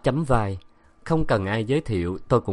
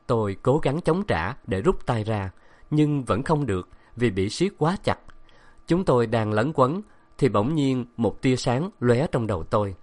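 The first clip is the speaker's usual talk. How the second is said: ordinary delivery, nothing marked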